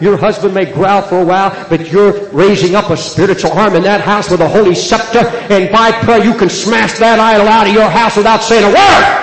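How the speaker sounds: rising preaching climax; building to a roar. Real, not acted. Preaching builds to full force.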